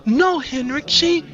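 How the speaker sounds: high, loud, cut-off protest; frightened protest. A narrator's reading. Sandra thinks Henrik will hit her, frightened.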